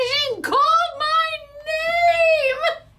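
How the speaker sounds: high, loud drawn-out squeal; mock winner's scream. Vlogger voicing the winner hearing her name, high loud squeal. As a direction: shriek like the delighted winner. Playing winner's shriek of joy.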